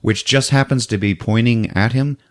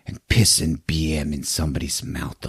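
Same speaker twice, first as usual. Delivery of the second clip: whispered rant; 10 semitones lower, 8 dB quieter than usual. Acted. Dee's rant, quoted.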